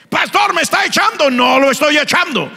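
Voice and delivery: loud, high, chanted sermon climax; fiery preaching. Acted. Preacher calls the congregation to the altar, loud chanted preaching. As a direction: hammer it at the congregation.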